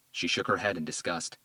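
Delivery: ordinary delivery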